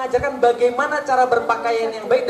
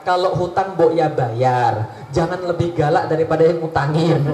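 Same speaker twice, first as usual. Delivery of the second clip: slow, low drawn-out scolding; folksy advice voice; 6 semitones lower than usual. Pretend. Preacher voicing gentle moral advice, slow and low. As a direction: scold gently like a village elder. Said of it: "Andaikan Rasul datang, cuma bawa pemikiran".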